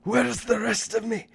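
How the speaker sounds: high, loud Scottish yell; Scottish accent scream. Acted. GM voicing the berserker's Scottish cry, loud Scottish scream. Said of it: "scream in a Scottish accent".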